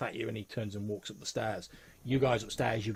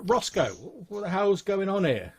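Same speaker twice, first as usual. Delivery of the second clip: higher, louder sheriff demanding answers; 10 semitones higher, 8 dB louder than usual. Acted. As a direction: call the barkeep to account. Sheriff confronts barkeep over wreckage.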